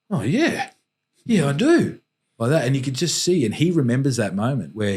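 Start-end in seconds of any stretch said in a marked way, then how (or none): 0.1-2.0 put-on pitched much higher, a small boy catching on; imitating young son. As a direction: voice the boy's sudden realization up high. Son's reply voiced in story.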